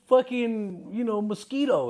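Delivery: mock a spoiled kid's fear of sun; mocking whiny kid. Mocking today's kids afraid of sunburn, high and whiny.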